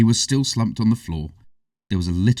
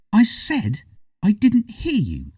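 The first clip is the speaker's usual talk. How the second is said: demanding; 13 semitones higher, 3 dB louder, slower than usual. A narrator's reading. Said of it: "she demanded".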